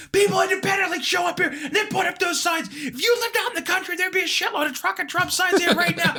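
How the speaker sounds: high, shouted comeback; retold losing it. Poll worker yells back at the wife, high shout. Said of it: "I finally just lose it".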